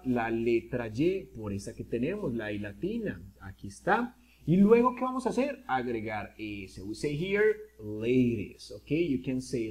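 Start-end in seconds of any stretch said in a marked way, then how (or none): none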